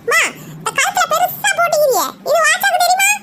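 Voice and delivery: put-on high, giggling boy's voice, sly; teasing grandson. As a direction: tease grandma with a laugh like a naughty kid. (சிரிப்புடன் கிண்டல் செய்யும் பேரன் குரல்)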